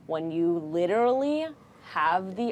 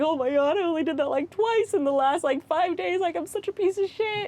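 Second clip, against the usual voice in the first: high, louder self-scolding; mock self-horror; 12 semitones higher, 4 dB louder than usual. Mother realizing she ignored her kid, high dismay. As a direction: shriek at yourself in dismay. Self-reproach, voiced thought.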